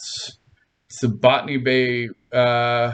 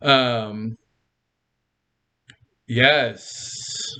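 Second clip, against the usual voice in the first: ordinary talk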